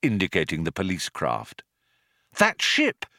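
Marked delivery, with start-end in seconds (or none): 2.4-3.1 high-pitched, eager question; sudden excitement. A narrator's reading. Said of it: "said Ford in sudden excitement".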